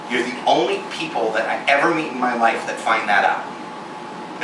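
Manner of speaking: ordinary talk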